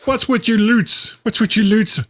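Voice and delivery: high, hammed-up Scottish complaint; horrible Scottish accent. Host riffs on slang in horrible Scottish accent. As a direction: do a bad Scot, pitched right up. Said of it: "That's like my horrible Scottish accent".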